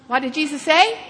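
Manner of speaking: ordinary talk, nothing marked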